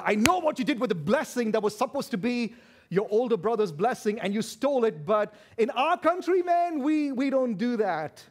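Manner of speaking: loud, high rebuke; angry accusation. Acted. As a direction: burst out accusing the cheat. Acts Laban's outburst at Jacob.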